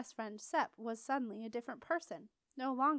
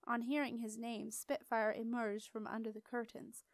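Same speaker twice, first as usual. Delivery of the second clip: ordinary delivery, nothing marked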